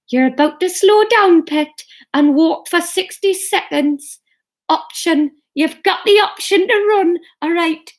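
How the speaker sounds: high-pitched Geordie coaching voice; Sarah Millican. Acted. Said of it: "Couch to five K that I've got with Sarah Millican".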